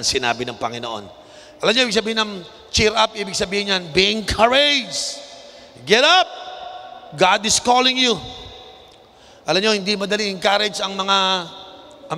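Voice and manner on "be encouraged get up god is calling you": high, emphatic preacher's call; rousing exhortation. Acted. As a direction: urge them up with full conviction. Preacher re-enacts call to blind man. (madamdaming panghihikayat)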